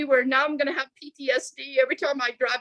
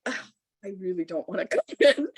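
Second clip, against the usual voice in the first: reluctant; 2 semitones lower, faster than usual. Retold or imitated. Reluctant aside after being invited in.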